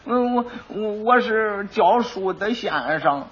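slow, soft, stammering lie; hesitant lie. Acted. Quack nervously lying to the magistrate, slow stammer. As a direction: stall and fumble the cover story.

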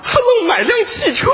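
high, slow, wailing complaint; mock anguish. Grumbling that Huzi bought a car, slow high mock wail. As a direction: drag it out like it's a tragedy.

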